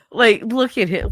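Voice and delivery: usual speaking voice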